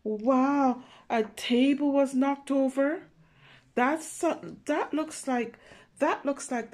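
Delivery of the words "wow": usual speaking voice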